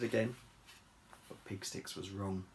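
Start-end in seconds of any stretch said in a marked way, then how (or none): none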